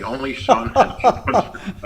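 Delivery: words breaking into high laughter; laughing. Real, not acted. Teller laughing while reading his fake telegram. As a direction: laugh through the line.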